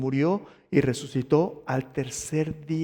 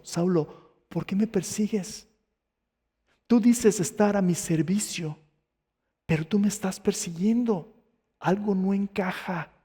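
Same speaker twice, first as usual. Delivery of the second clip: higher, slow questioning reproach; voice from heaven; 5 semitones higher than usual. Acted. Jesus confronts the persecutor Saulo, higher slow reproach. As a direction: confront him slowly in a raised pitch. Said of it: "esta voz dice algo".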